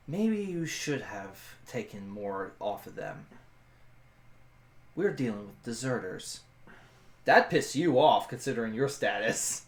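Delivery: lightly acted low, soft reproach; a touch of grim reproach, kept low; grave soldier character. Player voices her character.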